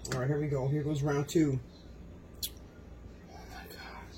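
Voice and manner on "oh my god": whispered Oh my God; awed whisper. Real, not acted. Cook taking a second round of dumplings, awed whisper. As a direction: whisper it, overwhelmed.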